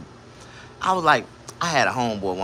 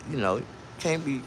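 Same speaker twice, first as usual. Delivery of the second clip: usual speaking voice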